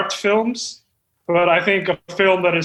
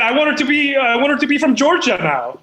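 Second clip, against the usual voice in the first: higher, gushing excitement; excited writer; 7 semitones higher than usual. Acted. Writer gushes to the cast, excited and higher. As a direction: bubble over with the new idea. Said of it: "I'm so excited".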